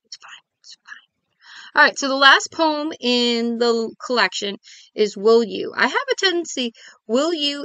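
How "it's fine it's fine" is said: soft breathy whisper to herself. Real, not acted. Reassuring herself in a whisper after feeling weird. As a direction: whisper it, half to yourself.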